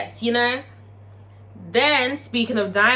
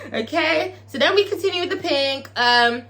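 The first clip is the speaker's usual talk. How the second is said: ordinary delivery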